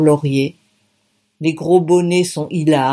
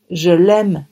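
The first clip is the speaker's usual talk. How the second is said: ordinary delivery, nothing marked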